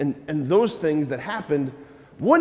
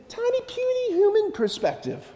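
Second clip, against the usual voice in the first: squeaked up high, tiny and puny; mock tiny voice. Preacher belittling human sight, mock squeaky voice. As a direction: shrink the voice to make humans sound small. Mocking our small view.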